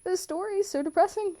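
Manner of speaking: hammed-up crying complaint; playfully overdone weepy whine; mock whiny sob. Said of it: "And I'd be like".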